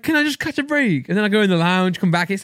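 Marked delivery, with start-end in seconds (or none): 0.0-1.1 high-pitched retold complaint; mock exasperation. Viewer who keeps missing goals, mock-exasperated high voice. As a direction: whine it high, in playful despair.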